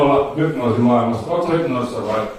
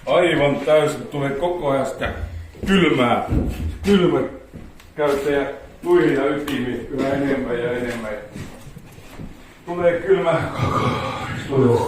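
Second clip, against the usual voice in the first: hypnotic suggestion; 4 dB quieter than usual. Acted. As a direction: lull them into feeling the cold. Hypnotist suggests freezing cold.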